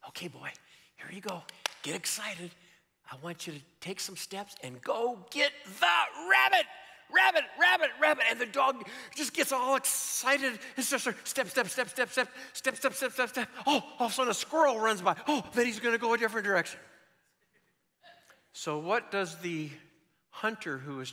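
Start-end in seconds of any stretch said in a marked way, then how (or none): 0.1-2.5 quiet, coaxing dog-trainer voice; hunter coaxing his dog. Acted. Hunter readies his puppy. 3.1-8.2 starts contained, builds to a high, loud shout; excited dog-trainer shout; hyping up the dog. Acted. Hunter hypes up his dog. 8.3-16.8 high, breakneck storytelling; frantic, high-pitched comic narration; excited puppy dash. Acted. Acts out the excited dog's dash.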